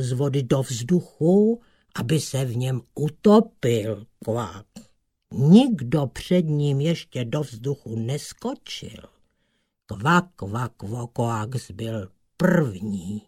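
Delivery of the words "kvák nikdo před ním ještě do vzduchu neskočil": ordinary delivery, nothing marked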